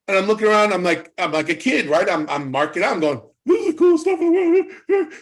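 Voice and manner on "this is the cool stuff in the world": high, giddy fanboy squeal; excited kid. Retold or imitated. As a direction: gush like a starstruck kid. Marking out like a kid.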